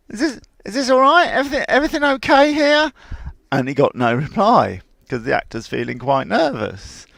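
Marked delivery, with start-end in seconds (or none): 0.1-2.9 much higher, fast, worried horse voice; a horse nervously asking again and again; a horse's rising worry, hurried and high; anxious horse. Retold or imitated. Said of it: "So he went".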